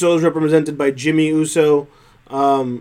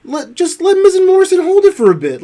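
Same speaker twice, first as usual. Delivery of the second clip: exasperated; 14 semitones higher, 4 dB louder than usual. Real, not acted. Exasperated rant about title booking.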